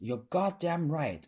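high, slow emphatic call; supportive shout. A narrator's reading. Drag queen behind the speaker, shouting in approval. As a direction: shout agreement, stretching the words. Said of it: "drag queens behind me shouted".

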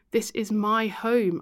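slow, each word weighted; slow firm emphasis. Acted. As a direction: lay down the law slowly. Firm boundary at door.

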